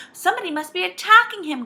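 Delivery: high, loud alarmed cry. A narrator's reading. Joe fears an attack overhead, crying out. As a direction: exclaim in alarm. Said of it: "cried Joe".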